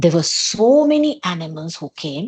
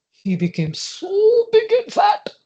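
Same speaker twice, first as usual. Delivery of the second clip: big and fat; 14 semitones higher than usual. A narrator's reading. Frog grown huge.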